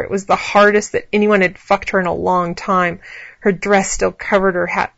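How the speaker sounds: ordinary delivery, nothing marked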